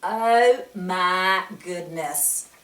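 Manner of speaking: ordinary delivery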